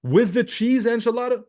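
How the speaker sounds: high-pitched teasing disbelief; mock disbelief. Coach teasing a viewer about his dinner, high mock disbelief. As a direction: act scandalised at his dinner.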